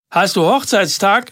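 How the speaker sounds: called across the street, pitched high; calling out. A narrator's reading. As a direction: shout the question to a passing friend. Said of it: "rief er".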